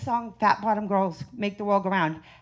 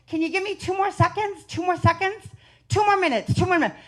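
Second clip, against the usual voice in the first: imitating doctor; 7 semitones higher than usual. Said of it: "She's like".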